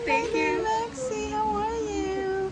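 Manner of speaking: high cooing voice for the dog. Real, not acted. Owner greeting her dog after surgery, high cooing baby talk. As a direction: coo to your pet, high and sweet.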